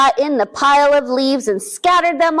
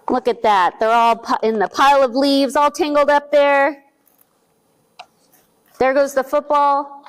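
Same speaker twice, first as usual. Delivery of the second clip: usual speaking voice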